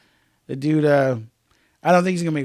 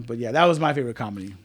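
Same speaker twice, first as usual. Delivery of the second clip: usual speaking voice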